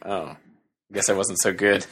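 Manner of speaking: small, low, slow voice; deflated mumble, softly acted; mock dejection. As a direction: play a crestfallen woman quietly conceding. Imagined guard who got lesser car.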